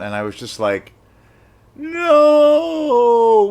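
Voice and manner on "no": a long, high, loud cry of dismay; anguished no. Retold or imitated. Told the film opens next week, a loud, high anguished cry. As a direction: wail it like the world just ended.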